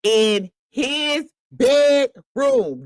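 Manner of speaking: "in his bedroom" very slow, every word stretched; drawn-out emphatic repeat. Shown as a demonstration. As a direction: stretch "bedroom" in mock disbelief.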